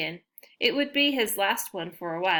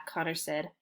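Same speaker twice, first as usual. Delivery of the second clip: ordinary delivery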